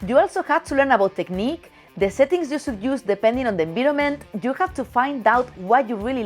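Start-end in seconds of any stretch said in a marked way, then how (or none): none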